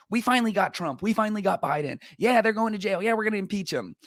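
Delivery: high-pitched imitation of gleeful partisans; mock excitement. Both political sides celebrating a catch, mock excited. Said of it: "Both sides react that way".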